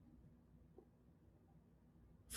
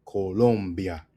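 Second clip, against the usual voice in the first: slow "Colombia" as ko-LOM-bya; syllable emphasis; 3 semitones lower, slower than usual. Shown as a demonstration. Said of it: "Emphasis on the third syllable".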